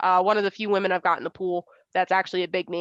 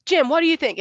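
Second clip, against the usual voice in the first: higher and louder puzzled ask; imitating Dave; 9 semitones higher, 5 dB louder than usual. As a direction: toss the question over to the dad. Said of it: "And Dave's like".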